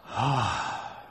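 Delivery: one long weary sigh; demo sigh. Shown as a demonstration. Showing the congregation a weary sigh. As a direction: let out a heavy tired sigh. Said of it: "Something like this".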